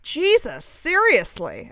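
high, drawn-out incredulous retort; exasperated disbelief. Retold or imitated. Chiming in to the guys, exasperated disbelief. Retold reaction to the guys' remark.

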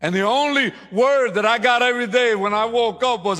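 high, loud preacher build; full-force preaching. Acted. Preacher drives the church toward its verse, loud and high. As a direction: preach it to the rafters.

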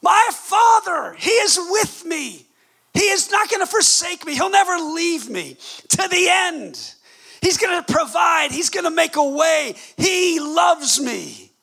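high, slow, drawn-out shouted declaration; stirred, joyful cry. Acted. Preacher voicing a believer's heart stirred by the Father, joyful cry. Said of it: "is there something that stirs up inside".

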